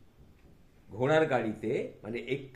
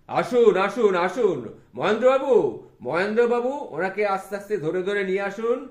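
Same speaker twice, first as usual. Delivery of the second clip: louder welcoming call from above; 10 semitones higher, 11 dB louder than usual. Acted. Vidyasagar calling down to welcome the guests, louder. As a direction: call down warmly from the balcony.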